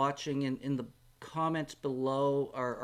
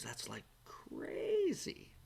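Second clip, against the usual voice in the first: amazed whisper; 13 semitones higher, 4 dB quieter, much slower than usual. Real, not acted. Amazed whisper about view count.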